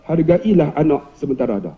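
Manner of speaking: low, softened advice. Acted. Principal advises teacher to value children, low gentle tone. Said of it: "dia nada rendah".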